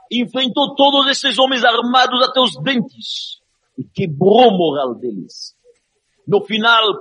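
impassioned high, loud sermon; preaching at full force. Acted. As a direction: preach it, rising to a roar. Preaching the Rebbe's courage.

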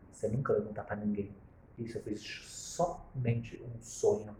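very slow and soft, deliberate; slow soft reassurance; slow calm verdict. Retold or imitated. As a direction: settle it gently and firmly. Rabbi's firm quiet advice.

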